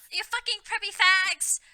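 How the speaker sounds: high, loud furious yell; angry shout. A narrator's reading. Sirius insulting the preps, furious high shout. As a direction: scream the insult at full blast. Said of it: "Sirius shooted angrily".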